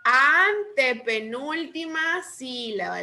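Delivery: Antepenúltima said slowly, its first syllable stretched; drawn-out correction. Real, not acted. Teacher corrects a student's answer, stretching Antepenúltima slowly. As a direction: stretch the opening of Antepenúltima, syllables clearly spaced.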